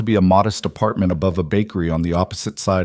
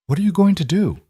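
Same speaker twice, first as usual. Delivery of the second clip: alarmed question; 10 semitones higher than usual. A narrator's reading. Elena alarmed at Adrian.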